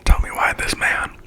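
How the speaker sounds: ordinary delivery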